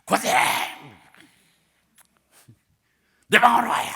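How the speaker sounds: loud, high, forceful summons; re-enacted shout. Pastor summoning the yelling elder, re-enacted shout. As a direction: shout the order across the church. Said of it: "저도 소리를 질렀죠".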